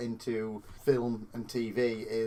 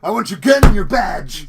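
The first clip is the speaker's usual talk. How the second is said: a hammed-up, higher yell of a movie captain; shouting police chief; 6 semitones higher, 14 dB louder than usual. Mocking a cliché cop film, the chief demanding the badge in a hammed-up yell.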